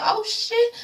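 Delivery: high, hushed "oh shit"; jaw-dropping surprise; shocked gasp. Real, not acted. Shocked reaction.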